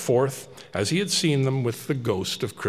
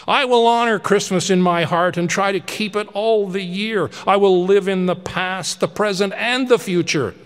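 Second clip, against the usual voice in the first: louder, higher frantic begging; desperate pleading Scrooge; 7 semitones higher, 5 dB louder than usual. Acted. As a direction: cry out for mercy over your own grave. Scrooge vows to change.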